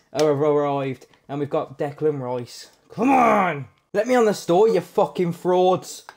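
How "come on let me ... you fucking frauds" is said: loud, higher exasperated shout at the servers; frustrated yell. Real, not acted. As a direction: yell at the lagging store. Servers won't load.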